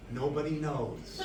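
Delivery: low, soft, would-be mysterious answer. Retold or imitated. Freshman answering a reporter carefully, mysterious. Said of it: "intelligent and maybe even a little bit mysterious".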